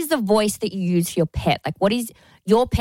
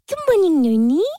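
high, loud sing-song morning greeting; owner greeting her dog; 9 semitones higher, 4 dB louder than usual. Retold or imitated. Owner greets her little dog each morning, high and bright. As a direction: chirp it up to the dog like a doting owner. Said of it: "That's how I talk to him".

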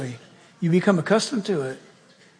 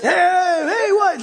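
higher, brash "hey, hey, what?"; mock loudmouth; 9 semitones higher, much slower than usual. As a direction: taunt like a pest nobody listens to. Imitating the noisy enemy.

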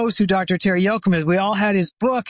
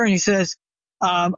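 usual speaking voice

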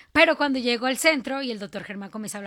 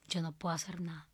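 whispered surrender; 4 semitones lower, 10 dB quieter than usual. Retold or imitated. Retold surrender to God.

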